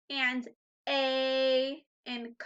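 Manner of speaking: very slow, drawn out; slow teaching voice. Shown as a demonstration. Teacher isolating vowel sounds, slowly. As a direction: stretch each sound for children. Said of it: "two vowel sounds".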